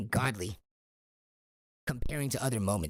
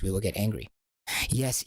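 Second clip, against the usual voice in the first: ordinary talk, nothing marked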